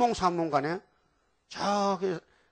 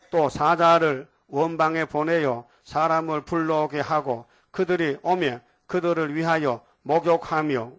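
slow, louder solemn reading of scripture; 5 dB louder, slower than usual. Acted. Preacher reading the verse to his congregation, slow and solemn.

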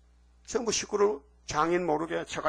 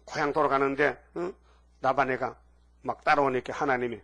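usual speaking voice

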